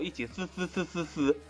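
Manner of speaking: hissing like a snake. Acted. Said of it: "学蛇叫".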